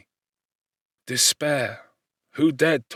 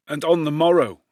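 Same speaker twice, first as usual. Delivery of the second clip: plain reading voice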